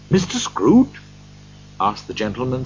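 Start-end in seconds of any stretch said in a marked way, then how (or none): 0.1-1.0 surprised question with a raised, rising pitch. A narrator's reading. Gentleman surprised to be greeted by Scrooge, questioning. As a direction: ask it in mild astonishment.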